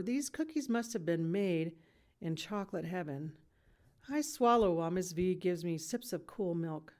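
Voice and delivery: usual speaking voice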